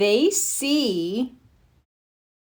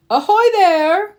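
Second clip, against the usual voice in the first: high, drawn-out hail from a boat; stretched-out sailor's greeting, pitched up; calling out; 10 semitones higher, 7 dB louder than usual. A narrator's reading. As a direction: hail them loudly and cheerfully. Grandpa Pig calls from boat.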